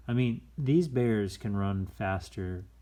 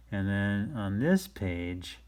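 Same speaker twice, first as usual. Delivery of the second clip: usual speaking voice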